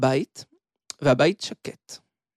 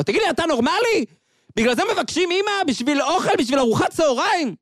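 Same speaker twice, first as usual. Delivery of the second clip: much higher, indignant scolding, faster at first; furious father; 10 semitones higher than usual. Acted. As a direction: tear into the boy for crying over lunch. Father slaps and scolds son. (אב זועם ונוזף)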